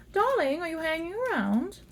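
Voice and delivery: high, sing-song call to a lost card; lilting high call for the missing card; high sing-song coax. Pretend. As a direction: coo at the card like a pet. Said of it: "Hangman, where are you?".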